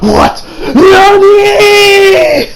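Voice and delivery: high, loud mock shriek; hammed-up cry of astonishment; shocked disbelief. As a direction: shriek it in exaggerated anime shock. Acting villain's shock.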